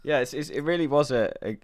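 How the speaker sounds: normal talking voice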